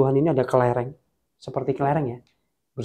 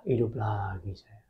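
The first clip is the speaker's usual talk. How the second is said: whisper; 5 semitones lower, 10 dB quieter than usual. Retold or imitated. Man waking, deflated, back alive.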